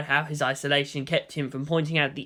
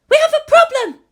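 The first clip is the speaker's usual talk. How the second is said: put-on much higher, louder urgent girl's voice; urgent young woman; 11 dB louder than usual. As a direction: break in with bad news. Maya reports in suddenly.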